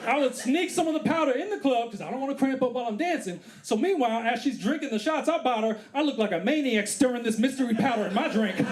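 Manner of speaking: ordinary delivery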